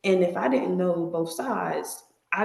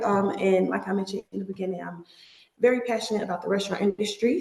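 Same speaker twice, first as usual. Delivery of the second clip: ordinary talk, nothing marked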